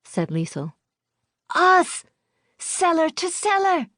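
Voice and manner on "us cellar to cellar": put-on high, loud child shout; excited child. A narrator's reading. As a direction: shout it like a thrilled kid. Child exclaims.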